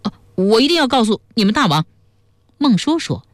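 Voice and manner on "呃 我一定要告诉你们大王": high, fast protest after a slip; flustered self-correction. A narrator's reading. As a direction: hastily correct yourself in a raised pitch. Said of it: "又改口说".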